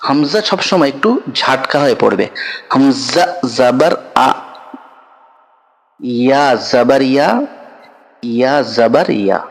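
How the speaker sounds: slow, drawn-out chanting of letters for pupils; teacher reciting the alphabet slowly to children, syllable by syllable; slow letter recitation. Shown as a demonstration. Students learning Arabic letters, slow drill recitation.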